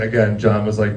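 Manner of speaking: ordinary talk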